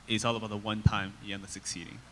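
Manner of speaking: usual speaking voice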